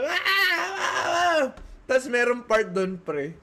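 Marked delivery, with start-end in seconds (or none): 0.0-1.4 high-pitched re-enacted scream; mock screaming. Retelling the noisy walk through the horror house, high mock scream. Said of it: "di naglalakad na".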